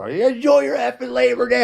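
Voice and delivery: high mock cussing yell; cussing at boaters. Yelling at passing boaters, a mock angry cuss. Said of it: "I was cussing".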